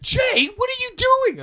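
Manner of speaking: very high, loud, slow shocked shriek; a girlfriend aghast at the scene; Kate's shocked voice. Retold or imitated. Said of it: "and Kate's like".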